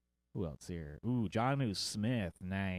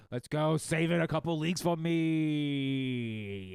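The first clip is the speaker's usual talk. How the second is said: excited cheer; 5 semitones higher, 5 dB louder, slower than usual. Real, not acted. Cheering his own fantasy pick.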